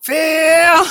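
loud high call of a name. Real, not acted. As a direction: shout it up high. Calling out friend's name.

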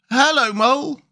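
high-pitched animal character greeting; Rat. Acted. Rat greeting Mole across the river, high character voice. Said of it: "said the water rat".

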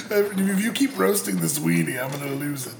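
ordinary delivery

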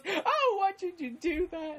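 very high, mock-hurt protest; wounded camp wail. Imitating the man slapped in the film, high wounded wail. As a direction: squeal it like a slapped drama queen. Acted reaction to being slapped.

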